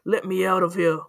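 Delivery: ordinary delivery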